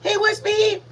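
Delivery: high, loud shout; loud call. Real, not acted. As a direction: call out across the room. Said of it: "Sorry, I just yelled in your ear".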